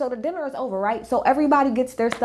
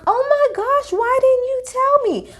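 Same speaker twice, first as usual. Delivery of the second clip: much higher, slowed, put-on shocked voice; mock Garcelle; slower than usual. Recapping Garcelle's reaction to viewers, high mock-shocked voice. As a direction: camp it up as a wide-eyed gasping friend.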